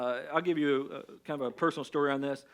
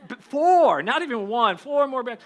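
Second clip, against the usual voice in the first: much higher, louder disbelief; four more bags, loud and high; exasperated outburst; 7 semitones higher, 10 dB louder, slower than usual. Retold or imitated. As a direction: blurt it out in mock outrage. Exasperated retelling of extra bags.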